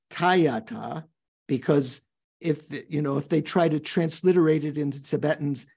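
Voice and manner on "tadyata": "Tadyata" as tie-ya-ta, no d; Tibetan pronunciation. Shown as a demonstration. Monk showing students how Tibetans say it, Tibetan pronunciation. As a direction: say "Tadyata" with a tie sound, d silent. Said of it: "So the Tibetans say".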